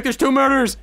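first, restrained mock outcry; mild mock alarm. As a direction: report it with a touch of panic. Voicing players, first take.

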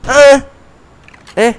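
very high loud "E?"; shouted challenge. Real, not acted. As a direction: bark it like a challenge. Gamer raging at game.

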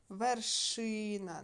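Вершина drawn out slowly on ШИ; slow верШИна, stressed vowel stretched; stress on middle syllable. Shown as a demonstration. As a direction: lean long on the и in Вершина. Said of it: "ненаголошений і наголошений звук".

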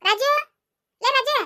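calling out. Acted. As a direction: call your pal out by name. Calls friend at the door.

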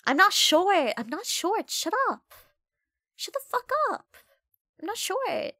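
high squeaky put-on voice; mock squeaky voice. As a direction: squeak it like a whiny little person. Plays a squeaky doubting voice.

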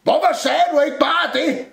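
high, loud exasperated question; shouted retort. A narrator's reading. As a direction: holler it back reproachfully. Cupboard shouts back in the quarrel. (råber bebrejdende)

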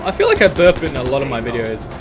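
usual speaking voice

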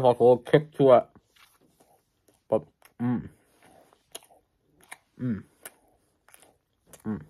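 talking while chewing; muffled between bites; mouth full. Real, not acted. Seen 0: bites taquito at 1.7–2.4, chews with mouth closed afterward.

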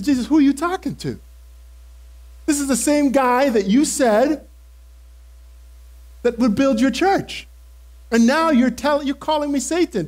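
indignant protest. Retold or imitated. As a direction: protest in hurt disbelief. Protests Jesus calling him Satan.

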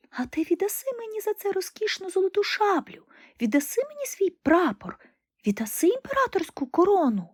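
a tiny bird bargaining with Death; nightingale's voice. Acted. (голос соловейка)

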